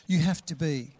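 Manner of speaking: usual speaking voice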